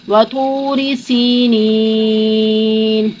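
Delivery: slow chanted tajweed recitation; Quran recitation. Shown as a demonstration.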